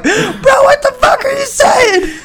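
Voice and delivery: high, laughing bewildered yell; baffled shout. Retold or imitated. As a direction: yell in total confusion. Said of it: "These Pokemon are like".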